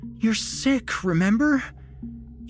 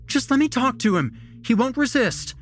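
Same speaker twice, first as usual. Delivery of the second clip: high, fast, loud plea; woman shouting; 6 dB louder, faster than usual. A narrator's reading. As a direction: shout over the officer urgently. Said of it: "she shouted".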